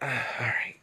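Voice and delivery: resigned sigh; breathy, sighing all right. Real, not acted. Resigned after Peggy interrupts.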